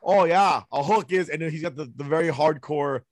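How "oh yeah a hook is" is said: put-on Canadian accent. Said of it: "hardcore Canadian accent".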